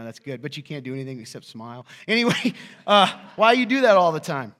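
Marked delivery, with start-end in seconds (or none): 2.1-4.5 laughing. Real, not acted.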